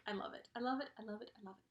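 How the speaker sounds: repeated, fading to near silence; fading gush. Real, not acted. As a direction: let the words trail away dreamily. Gushing, fading repetition.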